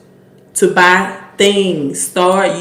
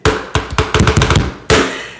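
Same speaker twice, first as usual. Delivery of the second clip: long breathy laugh without voice; helpless, real laughter. Real, not acted. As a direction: crack up at your own boasting. Laughs after playful boast.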